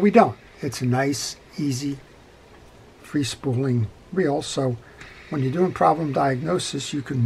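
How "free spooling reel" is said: ordinary delivery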